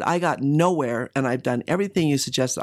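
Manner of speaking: ordinary talk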